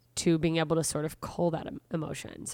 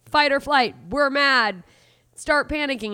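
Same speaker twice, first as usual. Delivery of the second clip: higher, louder panicked inner voice; mock alarm; 7 semitones higher, 6 dB louder than usual. The body shouting fight or flight, in mock alarm. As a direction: sound the body's alarm, half-playful.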